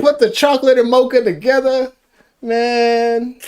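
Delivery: high, loud mocking voice; mocking squeal. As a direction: squeal it to mock him. Mocking friend's quote.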